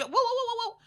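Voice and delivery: high, quick monkey whooping; imitated monkey noise. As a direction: mimic a hooting monkey. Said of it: "The monkey, look how he's".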